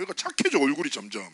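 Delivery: usual speaking voice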